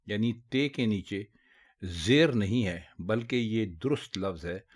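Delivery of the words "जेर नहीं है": ordinary talk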